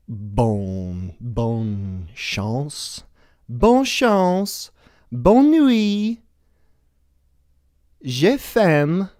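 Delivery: hammed-up fake French accent, high and loud; a clumsy put-on French accent, reciting school phrases; bad French accent. Showing off his middle school French, in a bad French accent. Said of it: "That's not really a French accent".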